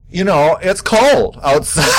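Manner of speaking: slow, high laughing excuse. Real, not acted. Laughing excuse for skipping exercise.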